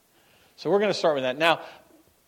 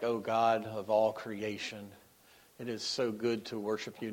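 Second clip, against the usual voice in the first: formal prayer; 4 semitones lower, 6 dB quieter than usual. Retold or imitated. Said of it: "didn't start out my prayer like".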